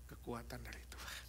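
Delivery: whisper. Acted. Preacher whispering to the congregation. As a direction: drop to a hushed whisper on the last words. Sermon climax drops to whisper.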